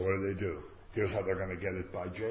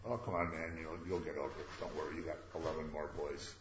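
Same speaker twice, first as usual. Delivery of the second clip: soft, lower, offhand comfort; mock consoling; 5 semitones lower, 8 dB quieter than usual. The wives brushing off Jacob's grief, soft and offhand. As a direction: toss off the comfort lightly, under your breath.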